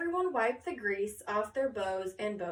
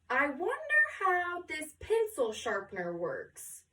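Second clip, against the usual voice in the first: curious musing; 5 semitones higher than usual. Acted. Josephina muses aloud.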